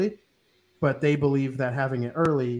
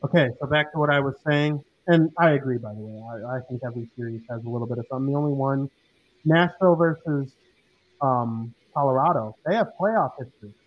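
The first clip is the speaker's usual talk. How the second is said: muffled mic. Real, not acted. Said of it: "you sound muffled".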